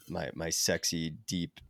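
hoarse, sick voice from covid; rough, husky voice while ill. Real, not acted. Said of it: "can probably hear my voice".

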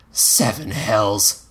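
put-on slow grumbling boy curse; mild curse. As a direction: mutter a bored kid curse. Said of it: "Egg cursed".

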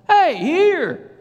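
loud, high shout across a field; urgent, high-pitched yell to come back; shouting to dog. Retold or imitated. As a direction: holler for a far-off dog. Calling dog fifty yards away.

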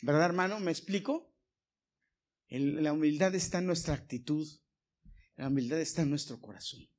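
normal talking voice

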